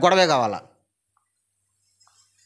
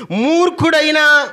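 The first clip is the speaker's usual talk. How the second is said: louder, higher, slow shout; 11 semitones higher, 6 dB louder, slower than usual. Shown as a demonstration. Preacher urging the congregation to say it louder, a slow loud shout. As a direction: belt the word out for the crowd. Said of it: "కొంచెం గట్టిగా చెప్పండి".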